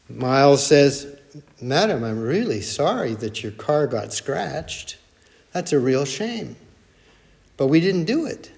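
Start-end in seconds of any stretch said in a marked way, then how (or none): 1.6-8.5 soft, measured sympathy, acted out; very calm. Grandson soothes angry woman in parking lot, very calm. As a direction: defuse it gently, as a calm peacemaker. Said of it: "Very calm".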